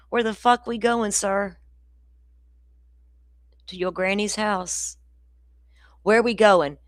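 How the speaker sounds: outraged Floridian snapping back at the anchor; indignant rant. Real, not acted. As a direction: throw it back at him, furious. Angry retort to news anchor.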